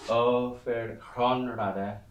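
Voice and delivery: "Ofer Chron Rada" said slowly, each word separate; slow Old English reading. Shown as a demonstration. Teacher reading Beowulf aloud, slow Old English. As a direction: draw out "Ofer Chron Rada" to show the alliteration. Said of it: "so that you got Chron".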